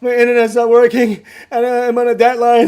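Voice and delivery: loud, high, frantic plea; a desperate customer about to lose it; panicked customer. Retold or imitated. Customer on the phone, pleading loudly about his deadline. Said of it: "And he was like".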